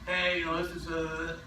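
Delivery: low, limp mumbled intro; imitating a worn-out telemarketer; tired caller. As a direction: drag through it like the three hundredth dial. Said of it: "I hear people on the phones tired".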